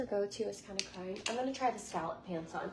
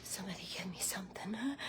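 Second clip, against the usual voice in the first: fast, soft unvoiced plea; whisper; 3 semitones lower, 6 dB quieter, faster than usual. Real, not acted. Asking viewers for an outfit verdict, a fast whisper.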